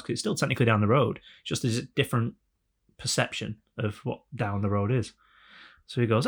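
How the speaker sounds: usual speaking voice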